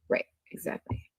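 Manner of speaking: breathy aside under the breath; real whispered aside. Real, not acted. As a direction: whisper it to yourself. Aside during reading messages.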